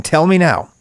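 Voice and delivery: ordinary delivery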